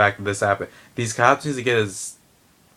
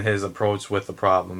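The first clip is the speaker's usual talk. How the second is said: normal talking voice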